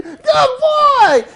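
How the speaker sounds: high, loud, over-the-top praise; really excited. Acted. Owner thrilled the dog sat, excited shriek. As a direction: shriek it with delight. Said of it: "now you're really excited".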